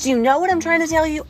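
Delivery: high, fast, loud sass; sassy retort. Acted. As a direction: fire it back quick and high.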